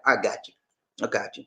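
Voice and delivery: normal talking voice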